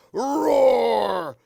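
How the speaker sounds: big loud dinosaur roar. Acted. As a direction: roar like a T. rex for the kids. Dinosaur book, reader roars.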